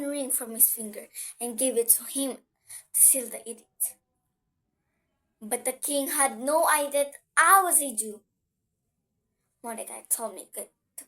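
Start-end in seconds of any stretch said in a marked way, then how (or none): none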